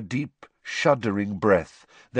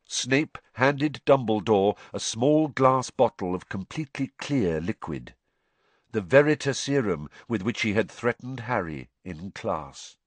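plain reading voice

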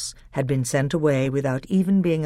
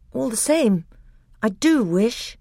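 put-on higher, wistful boyish voice; small boy longing; young boy voice; 9 semitones higher, 3 dB louder than usual. A narrator's reading. Caspian tells his uncle his wish, boyish and higher.

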